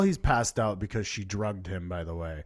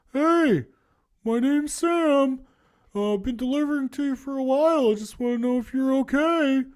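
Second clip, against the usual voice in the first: much higher, goofy delivery-guy voice; pizza guy voice; 13 semitones higher, 5 dB louder than usual. Retold or imitated. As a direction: play the awkward pizza guy talking through the door. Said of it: "the pizza guy introducing himself".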